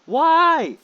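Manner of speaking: real exasperated shouting; exasperated yell. Real, not acted. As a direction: yell in despair at the unfairness. Ranting at an enemy player.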